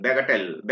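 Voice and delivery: bagatelle split into baga and telle; pronunciation demo. Shown as a demonstration. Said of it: "इसको किया बैगा and इसको बोल दिया टेल".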